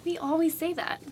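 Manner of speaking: whispered aside; whispering. Real, not acted. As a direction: hush it like a secret aside.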